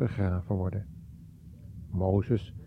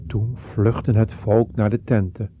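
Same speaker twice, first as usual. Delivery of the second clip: ordinary delivery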